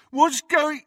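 high, jeering imitation, cut off; mimicking. A narrator's reading. Henchman aping the friend's question, mimicking. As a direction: parrot the question mockingly. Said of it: "mimicked Crabbe".

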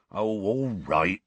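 slow, resigned giving in. A narrator's reading. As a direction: sigh it out, giving up. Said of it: "in a resigned voice".

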